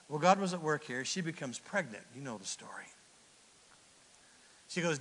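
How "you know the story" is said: soft low whispered aside. Real, not acted. Preacher confiding to the congregation, whisper. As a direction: confide it quietly.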